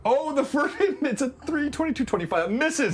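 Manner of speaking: high-pitched, laughing excitement; real excitement. Real, not acted. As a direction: burst out at a lucky dice result. Dice roll reveals a miss.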